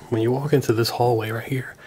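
normal talking voice

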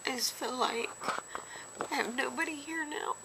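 real crying, high choked voice. Real, not acted. Confiding to viewers after loved ones move away, crying. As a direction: break down quietly, words pushed out high between long pauses. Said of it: "Sorry I cried in this vid".

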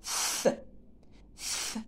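F as a long bare hiss of air; son F isolé. Shown as a demonstration. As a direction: sound out F as fff, not the letter name. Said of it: "fait le son F". (son « F » soufflé, fff)

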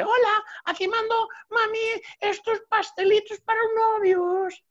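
put-on very high little boy's "Hola"; child's hello. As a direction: chirp it like a small kid. Jaimito delivers pastries. (Jaimito saluda con voz de niño muy aguda)

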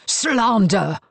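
loud furious bellow. A narrator's reading. Marcus bellows at Rosalind, furious shout. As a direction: bellow the accusation. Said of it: "bellowed Marcus".

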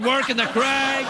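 ordinary talk, nothing marked

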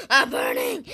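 high, loud wail of pain; agonized cry. Acted. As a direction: cry out as if on fire. Character is burning.

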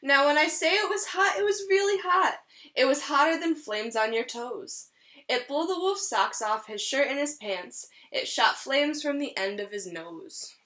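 lively storybook read-aloud; reading to children. Acted. Teacher reading a rhyming story to her class, lively. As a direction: read it like a picture book for a class. Reads picture book to children.

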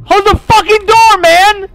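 high, loud frantic yell; panicked yell. Real, not acted. Scared player yelling to shut the door, frantic shout.

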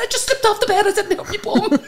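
high, giggly mock whimper; laughing pain. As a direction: laugh through a hurt bum. Retelling her fall, in pain.

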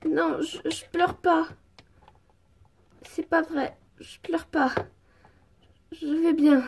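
acted, understated crying; tearful denial. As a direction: deny it while holding back tears. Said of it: "pourquoi tu pleures?".

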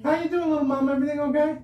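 high, sweet angel voice; little angel voice. Real, not acted. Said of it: "with my voice like this all the time".

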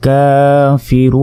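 very slow chanted word with drawn vowel; slow tilawat style; Quran recitation. Shown as a demonstration. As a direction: recite it melodically, one alif long. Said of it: "এক আলিফ পরিমাণ টেনে পড়তে হবে".

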